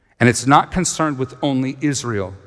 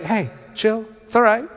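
lightly voiced, high easygoing reassurance; calm them down with a shrug; relaxed Jesus; 8 semitones higher than usual. Jesus calming scared disciples, light casual high voice. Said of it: "And Jesus is like".

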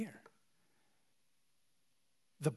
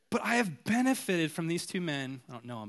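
ordinary talk, nothing marked

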